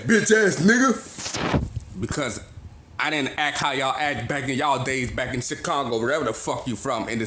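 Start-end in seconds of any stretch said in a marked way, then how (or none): 0.0-1.6 very slow, higher, drawn-out insult with a laugh; mocking muttered insult. Retelling the Kroger shopper's parting shot, slow laughing mockery. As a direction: drag the insult out, laughing at how petty it is. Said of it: "you turned your head and said".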